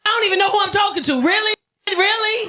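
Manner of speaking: high, exasperated challenge; mock indignation. Driver pissed off at the OnStar operator, exasperated. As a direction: throw each "really" at him, pitched high.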